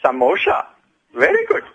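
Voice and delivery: a light put-on Indian accent, pitch jumping up on very good; hint at India with a hammed-up Indian accent; mock Indian accent. Host dropping a clue to quiz players in a mock Indian accent. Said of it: "I'll put on the accent".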